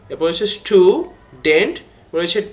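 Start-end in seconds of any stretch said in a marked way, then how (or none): 0.5-1.8 "student" in two separate beats, stu, dent; split into syllables. Shown as a demonstration. Teacher dividing "student" for pupils, split into two syllables. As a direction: say "student" with a clear gap between syllables. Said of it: "আমরা ভাগ করি".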